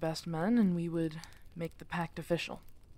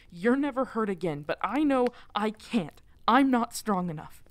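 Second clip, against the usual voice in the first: loud and higher, voice straining; anguished confession; 4 semitones higher, 9 dB louder than usual. Acted. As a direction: break on the admission of weakness. Emotional peak, can't protect.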